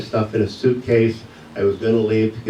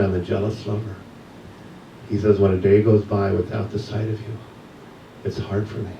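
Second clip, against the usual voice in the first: ordinary delivery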